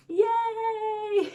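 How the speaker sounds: high, drawn-out happy cheer; gleeful "yay". Real, not acted. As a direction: celebrate the end of the story. Cheer as the story ends.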